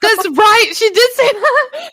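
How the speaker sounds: high, loud, laughing exclamation; laughing recognition. Real, not acted. Friend suddenly recalling mom's remark, high laughing outburst. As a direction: squeal it through laughter.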